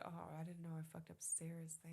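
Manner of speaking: soft, whispered voicing of the guilty dog. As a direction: whisper her guilty thoughts. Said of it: "She said".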